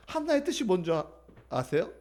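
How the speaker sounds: ordinary talk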